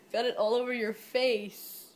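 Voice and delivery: ordinary delivery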